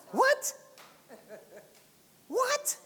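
high, rising what; incredulous. Real, not acted. Preacher reacting in disbelief, high rising. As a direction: squeak it up in disbelief.

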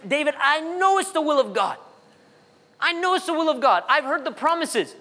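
high-pitched, insistent imitation of a believer; insistent protest. Struggling believer insisting to the pastor, imitated high-pitched protest. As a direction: plead the case as a struggling churchgoer.

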